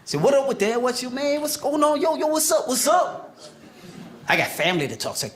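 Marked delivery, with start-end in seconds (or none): 0.2-3.4 put-on New York accent; New York street accent. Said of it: "sound like this here".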